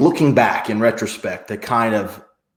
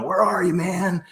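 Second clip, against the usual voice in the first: lightly voiced carefree friends, pitched higher; carefree beach friends; 7 semitones higher than usual. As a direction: toss it off like a buddy partying at the beach.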